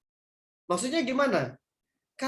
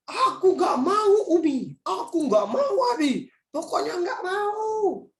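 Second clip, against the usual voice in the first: put-on high, slow whining child refusing; whiny child; 10 semitones higher, 4 dB louder, slower than usual. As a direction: wail like a stubborn child. Child tantrum acted by ustadz.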